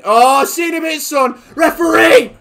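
high loud yells; yelling at the referee; shout. Real, not acted. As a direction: bellow at the screen. Shouting at pass and ref.

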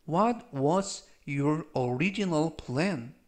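very slow, careful English read. Shown as a demonstration. Teacher reads example for students, very slow. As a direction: read it slowly for learners.

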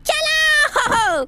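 high, very slow stretched-out yell; long drawn shout. Real, not acted. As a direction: drag the word out as a high yell. Urging partner to flee. (खींचकर ऊँची चीख़)

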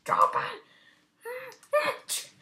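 squeaky high put-on voice; lightly hammed-up squeaky command; high mock voice. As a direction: jump up high for the order. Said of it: "this P D's like".

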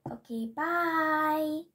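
slow, odd-voiced bye; drawn-out goodbye. Real, not acted. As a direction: stretch the goodbye out slowly.

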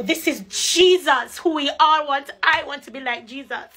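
normal talking voice

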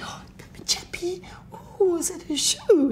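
imitated high falsetto coo; baby-talk it as if to a dog; airy, sky-high doting voice; talking to a pet. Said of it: "like she talks to a, a pit bull".

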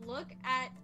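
normal talking voice

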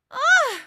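loud, high exasperated groan; shrill cry of exasperation; frustrated cry. A narrator's reading. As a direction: burst out in disgust. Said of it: "she cries".

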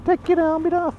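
squeaky high-pitched bossy voice; high mock order. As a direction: pipe it up high like a fussy guard. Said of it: "they'll probably say".

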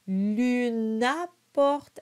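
slow, careful French: monta with a nasal O; slow French model. Shown as a demonstration. Coach reads the verse slowly for students. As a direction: sound out each French syllable. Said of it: "we keep the U sound".

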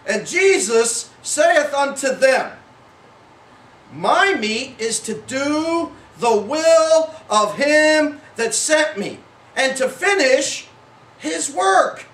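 slow, weighty reading, phrase by phrase; measured scripture reading. Shown as a demonstration. Preacher reading Jesus' words to the congregation, slow and solemn. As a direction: draw out each phrase deliberately. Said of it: "you read it differently than I read it".

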